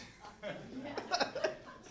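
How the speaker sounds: sudden high laugh; high burst of laughter. Real, not acted. Laughs, getting hot on stage.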